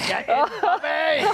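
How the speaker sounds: drawn-out excited yell of the name; excited villain shout. Acted. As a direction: cheer the name slow and loud. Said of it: "like the villain from Austin Powers".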